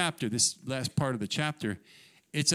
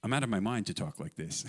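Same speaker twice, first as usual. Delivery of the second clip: parenthetical aside; 4 semitones lower, 4 dB quieter, faster than usual. Acted. Paul interrupting his own boasting, parenthetical aside. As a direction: drop lower into a wry aside. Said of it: "that little parenthetical statement".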